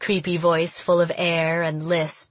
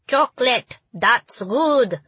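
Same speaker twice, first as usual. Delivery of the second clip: put-on German accent; 6 semitones higher, slower than usual. A narrator's reading. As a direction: shout it in a funny German accent. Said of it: "my impression of Ronnie's funny German accent".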